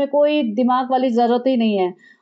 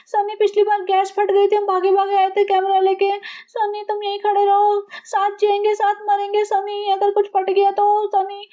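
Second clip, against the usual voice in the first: mock scared wife; 7 semitones higher than usual. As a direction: ham up the helpless fear. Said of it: "क्या नौटंकी मचा रखी है इसने". (ऊँची, डरी-डरी नौटंकी वाली आवाज़)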